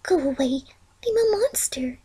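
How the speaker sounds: tiny, high whispered peep; a little peep of a voice; timid whisper. A narrator's reading. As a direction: whisper it, ashamed and small. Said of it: "a little peep of Fluttershy saying".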